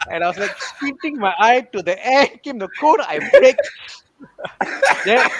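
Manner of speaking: retelling the hard braking with excitement; excited retelling. Retold or imitated. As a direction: build up to a shriek of laughter.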